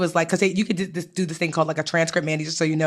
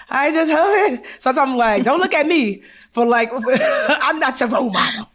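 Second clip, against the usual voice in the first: higher, laughing through the words; self-mocking plea broken up by laughter; real helpless laughter; 5 semitones higher than usual. Real, not acted. Host admitting tax mistakes to guests, helpless laughter.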